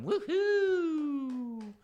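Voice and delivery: high whooping cheer; high-pitched celebratory woohoo. Real, not acted. Cheering the artwork.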